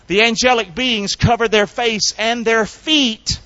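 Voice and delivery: loud, high sermon delivery; full-force preaching. Real, not acted. Preacher declaring God's holiness to the congregation, full-force preaching.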